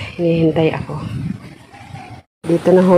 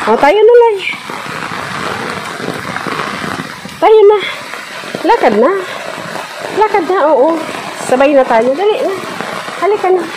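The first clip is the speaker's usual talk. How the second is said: coaxing; 7 semitones higher, 3 dB quieter than usual. Real, not acted. Coaxing the dog to come.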